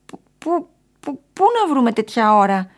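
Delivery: high, stuttering indignant complaint; stammer, then burst out loud and put out; grumpy, loud protest; sulky grumbling. A narrator's reading. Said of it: "έκανε ο κόμις Μελομακαρόνης κατσουφιασμένος".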